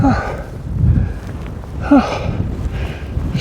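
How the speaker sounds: heavy gasps for air; panting hard, almost voiceless; breathless panting. Real, not acted. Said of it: "out of breath here".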